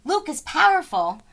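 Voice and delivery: usual speaking voice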